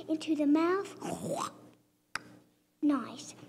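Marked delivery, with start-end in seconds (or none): none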